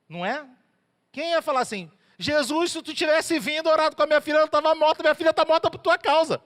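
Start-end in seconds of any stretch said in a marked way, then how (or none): 2.2-6.4 raised, higher and louder, blaming Jesus; a father furious over his dead daughter; rising indignant outburst; angry accusation. Retold or imitated. Jairo blames Jesus, voiced.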